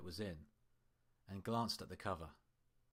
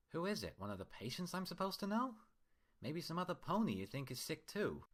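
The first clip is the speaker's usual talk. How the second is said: higher-pitched Twilight, sharp and skeptical; a wary patient firing back sarcastic questions; Twilight, prickly and sarcastic; 9 semitones higher than usual. Acted. Twilight pushing back at doctor.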